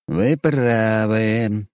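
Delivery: slow, pensive agreement; thoughtful. A narrator's reading. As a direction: say it slowly, lost in thought. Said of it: "задумчиво отозвался Вадим". (задумчиво)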